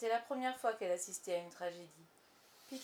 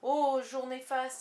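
slow, loud, higher lament; dismayed exclamation; 4 semitones higher, 9 dB louder, slower than usual. A narrator's reading. Starkey lamenting the captain's news, dismayed exclamation. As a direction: draw out the cry of doom. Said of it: "s'exclama maître Starkey".

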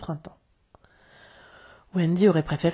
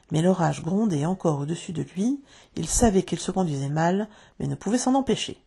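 plain reading voice

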